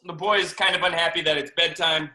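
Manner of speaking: plain reading voice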